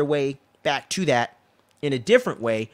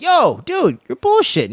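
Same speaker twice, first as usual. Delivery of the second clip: much higher, louder, disbelieving yelp; incredulous callout; 7 dB louder, slower than usual. Retold or imitated. Viewer reacting to the uncle's boast, high loud disbelief. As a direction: call out the lie in a high, loud squawk. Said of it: "And I'm like".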